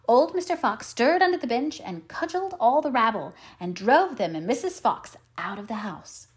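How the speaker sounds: ordinary delivery, nothing marked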